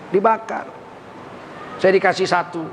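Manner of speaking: ordinary talk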